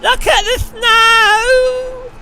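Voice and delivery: very high, loud and slow squeal; mock squealing wife. Mocking the wife excited by snow, high and drawn out. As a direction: drag it out in a shrill squeal. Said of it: "she was like".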